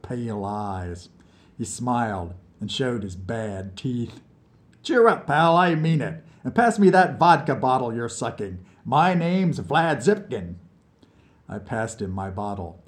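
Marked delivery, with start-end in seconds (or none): 4.8-10.5 higher, louder teasing jab; cheeky drunk from under the piano; mocking drunk. A narrator's reading. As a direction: poke fun at the crybaby. Vlad introduces himself.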